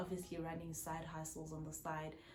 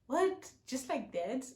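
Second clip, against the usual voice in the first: shocked disbelief; 6 semitones higher, 6 dB louder, slower than usual. Retold or imitated. Shocked reaction retold.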